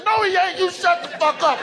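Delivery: high-pitched defensive outburst; hammed-up outraged denial; indignant denial. Acted argument over actor's origin.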